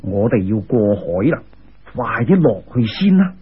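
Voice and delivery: put-on low old sage voice; immortal's voice. A narrator's reading. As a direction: say it deep and steady as the immortal. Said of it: "嗰个人又话啦".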